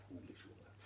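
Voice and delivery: ordinary delivery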